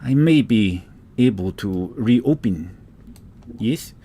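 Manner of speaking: put-on slow, halting Russian-accented elf. Elf recalls the Great Grove, slow Russian accent. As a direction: feel out each phrase in a Russian accent. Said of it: "It's a Russian accent".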